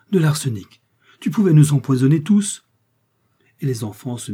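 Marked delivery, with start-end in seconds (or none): 0.1-2.6 loud, higher-pitched alarm; horrified cry. A narrator's reading. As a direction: exclaim in horror, building louder. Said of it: "s'écria madame Homais en joignant les mains". (cri d'effroi, voix forte et aiguë)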